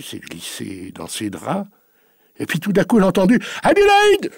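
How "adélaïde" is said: long high yell of her name; bellowed name. Acted. Old farmer bellows for his maid, loud call. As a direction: call her from across the farm at full voice. Master calls up at night.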